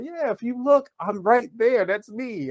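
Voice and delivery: high, eager, showing himself off; like spotting yourself on screen with glee; proud excited pointing out. Retold or imitated. Telling friends about his video moment, high and proud. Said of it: "I say".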